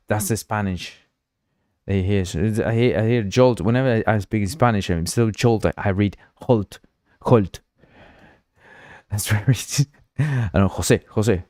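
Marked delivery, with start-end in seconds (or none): none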